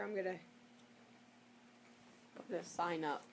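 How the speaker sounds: ordinary delivery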